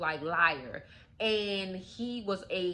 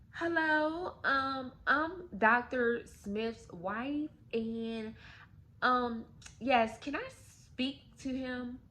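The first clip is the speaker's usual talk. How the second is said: polite sweet caller; 4 semitones higher, slower than usual. Retold or imitated. Kim politely calls his work.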